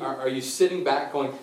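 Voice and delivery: ordinary talk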